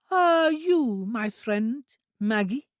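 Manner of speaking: put-on high, slow simple girl's voice; an eager, innocent young woman; high, drawn-out childlike voice; childlike Trudy. A narrator's reading. Trudy asks hopefully.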